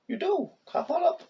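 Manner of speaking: high sing-song call to a cat; high coaxing pet-talk. Real, not acted. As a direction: coax the kitty up in a high pet voice. Coaxing the cat onto bed.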